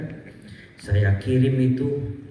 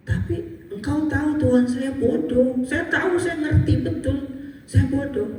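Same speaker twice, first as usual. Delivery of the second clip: pleading prayer; 13 semitones higher than usual. Retold or imitated. His desperate prayer in chapel.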